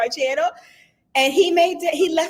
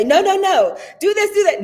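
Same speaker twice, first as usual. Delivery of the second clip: bossy mock orders; 10 semitones higher, 6 dB louder than usual. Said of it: "I don't say".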